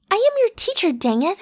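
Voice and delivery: indignant cry. Acted. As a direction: rise up in mock outrage. Indignant teacher outburst.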